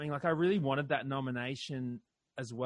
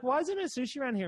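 high, fast, whiny question; higher, fast, indignant whine; exasperated complaint; 10 semitones higher, much faster than usual. Retold or imitated. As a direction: complain like a spoiled diner. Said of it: "and I'd be like".

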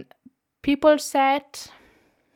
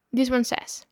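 usual speaking voice

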